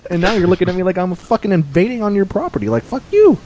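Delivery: exasperated delivery driver telling a customer off; indignant rant. Acted. Acted rant at rude customer.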